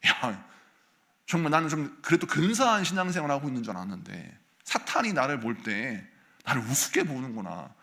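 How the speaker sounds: normal talking voice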